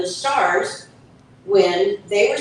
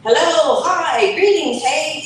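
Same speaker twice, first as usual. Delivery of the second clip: hip greetings; 8 semitones higher, 4 dB louder than usual. Acted.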